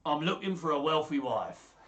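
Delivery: put-on dealer, speaking up; wheeler dealer. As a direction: pitch it like a market trader. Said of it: "like a wheeler dealer".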